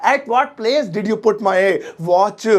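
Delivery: slow, clear English; teacher modelling the sentence; spaced-out words; slow model sentence. Shown as a demonstration. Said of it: "मेरी घड़ी आपने या तुमने किस जगह रखी".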